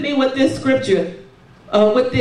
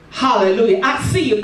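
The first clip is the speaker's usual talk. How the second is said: normal talking voice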